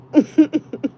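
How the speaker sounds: high, loud burst of laughter. Real, not acted. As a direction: cackle out loud. Laughing at her own joke.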